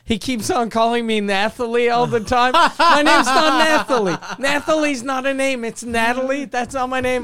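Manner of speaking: loud, exasperated, rising to a shout; annoyed shout. Retold or imitated. Julie shouting at the caller through the radio, exasperated. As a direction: yell it like a fed-up cashier. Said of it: "then she goes".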